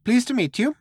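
very high little mouse greeting. A narrator's reading. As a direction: greet them as a tiny high-voiced mouse. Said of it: "The other mouse said".

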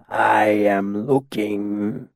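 very drawn-out words; thick, dragging delivery; slow, dazed speech. A narrator's reading. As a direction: speak as if befuddled and far away in thought. Dazed mercenary speaks as before.

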